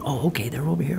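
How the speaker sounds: very soft, murmured to himself; hushed realization. Retold or imitated. Searcher hearing survivors at night, hushed realization. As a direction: say it under your breath.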